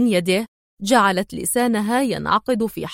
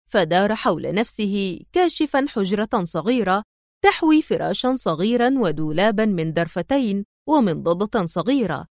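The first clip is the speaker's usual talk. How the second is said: usual speaking voice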